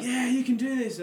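high cheering call of encouragement; sisters cheering. Retold or imitated. Sisters cheering a runner on, high and excited. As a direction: cheer him on like his sisters did. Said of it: "my sisters were going crazy".

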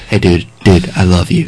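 low, mock-sincere declaration of love; mock heartfelt. Bandmate ending a pretend phone call to his buddy, mock heartfelt. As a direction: pretend to get tender with your buddy.

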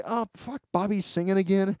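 high-pitched, exasperated mock groan. Fan about to complain at Bobby's singing, high-pitched mock groan. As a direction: play up the fan's dismay at Bobby singing. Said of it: "Just when I wanna say".

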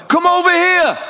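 high-pitched American holler; American accent. Acted. Player summoning the visitors courtside, American accent. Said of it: "That's my American accent".

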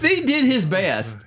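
high, slow pleading defence; mock hurt. Fan sticking up for Kevin Costner, mock hurt. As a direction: plead his case with hammed-up hurt.

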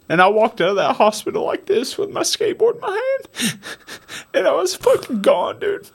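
fighting back tears as he tells it; choked-up crying. Real, not acted. Man crying as he tells of walking out alive. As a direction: voice breaking high with tears. Emotional climax of recovery story.

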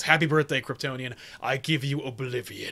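lightly voiced menace, trailing low; quoting Mongul with light menace; Mongul line. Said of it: "had that line where he says".

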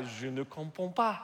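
French spoken as a bluff; mock French speaker; pretend French. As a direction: play at being French to dodge a conversation. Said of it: "I would pretend to be French".

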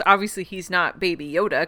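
usual speaking voice

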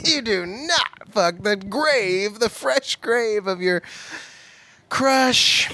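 slow, drawn-out warning, rising to high crush; teaching the rule to a friend, slow and sassy; slow mock lecture. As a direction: lay down the rule slowly like a sassy aunt. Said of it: "Rule number one, honey".

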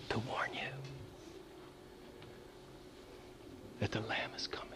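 solemn whispered warning; hushed ominous whisper. Acted. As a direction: breathe the warning to them.

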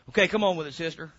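ordinary talk

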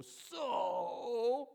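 So drawn out long and high, loud; emphasized so. Shown as a demonstration. Pastor shows the congregation his texting emphasis, long high So. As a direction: stretch the word So with many O's. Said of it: "I wanna emphasize the word so".

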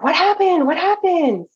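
high-pitched frantic demand, imitated; distressed, angry woman. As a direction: mimic an upset passenger, voice shooting up high. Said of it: "she looked really distressed, really angry".

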